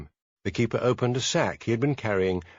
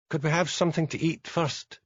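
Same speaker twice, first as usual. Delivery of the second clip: pleading; 6 semitones higher than usual. A narrator's reading. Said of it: "pleaded Josie".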